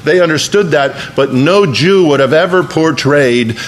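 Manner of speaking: a preacher building to a fervent climax; full-force preaching. Acted. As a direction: preach it out to the whole church.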